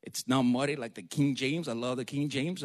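ordinary talk

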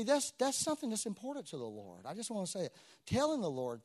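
usual speaking voice

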